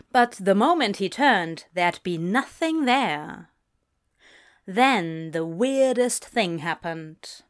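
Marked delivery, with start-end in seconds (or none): none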